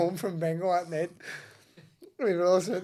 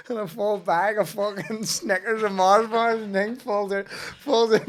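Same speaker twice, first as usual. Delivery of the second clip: higher and louder through laughter; laughing while telling; 7 semitones higher, 6 dB louder, slower than usual. Real, not acted. Telling the chocolate reveal, laughing hard. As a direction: push the words out through giggles.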